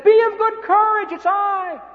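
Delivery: very high, loud call across the water. Acted. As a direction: reassure frightened men from the waves. Jesus calls across the water.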